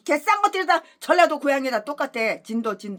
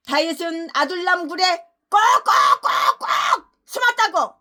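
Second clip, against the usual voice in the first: preacher's shout; 4 semitones higher, 6 dB louder, slower than usual. Acted. Dramatic preaching of the story.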